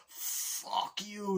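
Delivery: high put-on defiant voice; defiant retort. Henchman defying John Wick, high mock snarl. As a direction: spit it back at him.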